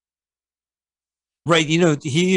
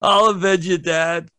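overacted vow; 6 semitones higher than usual. Hammed vow in reply.

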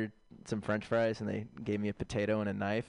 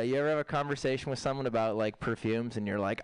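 normal talking voice

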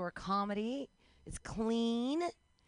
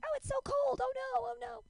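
very high, whimpering pretend distress; mock agony. Tied-up nanny playing along with freezing game, high mock agony. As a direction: feign helpless suffering for a child's game.

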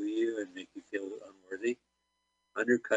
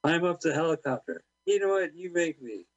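mock defiant; 7 semitones higher than usual. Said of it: "He's like".

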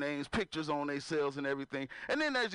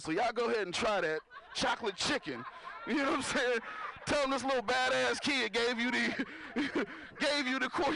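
ordinary delivery

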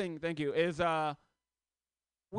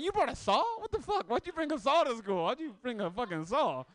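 shocked teacher; 6 semitones higher, 3 dB louder than usual. Retold or imitated. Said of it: "I'm like".

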